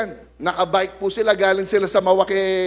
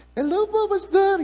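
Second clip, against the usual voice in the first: sweet-voiced Peter. Pastor imitating Peter greeting him, high sweet voice. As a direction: imitate his gentle, sugary hello. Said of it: "malambing yung boses po niya".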